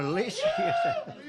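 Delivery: calling out. Real, not acted. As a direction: shout her name to the crowd. Calling out to Lisa in crowd.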